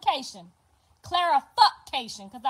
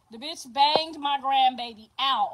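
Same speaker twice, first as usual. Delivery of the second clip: normal talking voice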